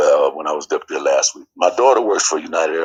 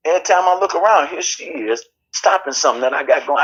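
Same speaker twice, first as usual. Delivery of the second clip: heated retold complaint; 10 semitones higher than usual. Retold quarrel with his mother.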